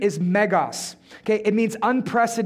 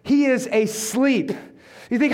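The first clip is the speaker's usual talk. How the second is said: ordinary delivery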